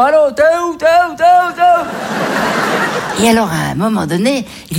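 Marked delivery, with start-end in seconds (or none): 0.0-3.2 high, anxious phone call; repeated high "t'es où". Retold or imitated. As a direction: call out to a lost partner, higher. Lost in store, calling on phone.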